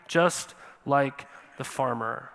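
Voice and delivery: slow, weighty delivery of the line; slow emphasis. Acted. Preacher to congregation, comparing them to the farmer, slow and weighty. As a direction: lean on it, drawing each word out slowly. Preacher repeats phrase for effect.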